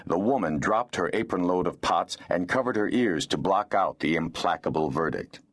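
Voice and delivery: ordinary delivery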